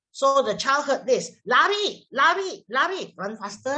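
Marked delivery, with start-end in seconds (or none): 1.4-3.1 echoed command. Acted. Acts what the child hears.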